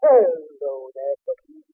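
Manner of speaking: slow, low jolly Santa greeting. Acted. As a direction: greet her like a kindly Santa, drawn out. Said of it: "Hello, Santa".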